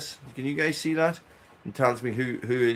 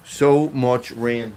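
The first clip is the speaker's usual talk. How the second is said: "So much rain" stretched out very slowly, each word long; drawn-out lament; 6 dB louder, much slower than usual. Real, not acted. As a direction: drag out "So much rain" like a weary sigh at the weather. Repeats complaint about rain.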